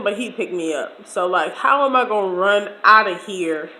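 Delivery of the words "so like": ordinary talk, nothing marked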